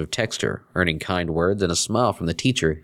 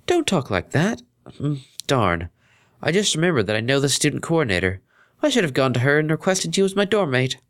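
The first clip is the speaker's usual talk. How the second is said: put-on high, proper young mare voice; polite friend over the phone; Octavia; 8 semitones higher than usual.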